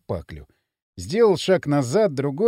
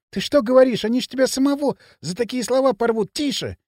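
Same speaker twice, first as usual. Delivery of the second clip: high, urgent warning, dropping at the end; mildly panicked hush; alarmed hushing; 6 semitones higher than usual. A narrator's reading. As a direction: warn anxiously, as if they might hear. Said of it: "зашептал он".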